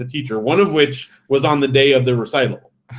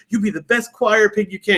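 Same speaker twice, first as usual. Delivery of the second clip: higher-pitched, imitated mom pep talk; mock-encouraging mother, voice lifted; retold motherly urging; 7 semitones higher than usual. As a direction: urge the kid on, pitched up, as if quoting a mom. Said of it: "And she's like".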